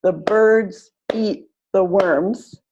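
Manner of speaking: slow, BIRDS and WORMS punched on each beat; teacher modelling rhythm, slow and clear; stress-timed demo. Shown as a demonstration. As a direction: clap it out, one beat per stressed word. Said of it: "same speed, same clapping".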